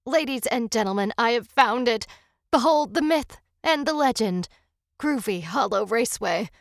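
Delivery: excited announcer-style reveal; triumphant announcement. Acted. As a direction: proclaim the find like a showman. Announcing his discovery on a livestream.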